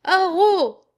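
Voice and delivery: one loud, high alarm call; old woman crying out for help; cried out. A narrator's reading. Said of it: "crie de nouveau la vieille". (cri d'alarme fort et aigu)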